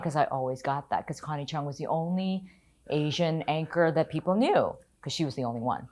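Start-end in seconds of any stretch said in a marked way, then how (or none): none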